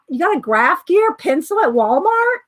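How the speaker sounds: real surprise, pitch climbing to "Walmart"; surprised question. Real, not acted. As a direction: repeat it as an incredulous question. Surprised reaction to viewer's news.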